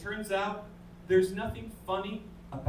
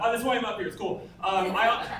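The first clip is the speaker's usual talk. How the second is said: ordinary talk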